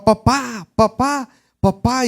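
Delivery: high pleading calls for dad; child shouting. Retold or imitated. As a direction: cry out for dad through the smoke. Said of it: "solo gritaba".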